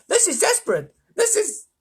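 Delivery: high, loud, frantic cry; frantic controller, very high; desperate. A narrator's reading. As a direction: panic until cut off mid-sentence. Ground control panics, cut off.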